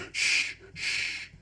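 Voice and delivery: sharp, voiceless shush. A narrator's reading. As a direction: hush your friend urgently without using your voice. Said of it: "I had to shush him".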